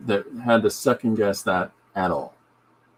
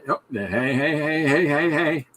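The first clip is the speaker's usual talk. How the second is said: mock [name] greeting; 5 semitones higher than usual.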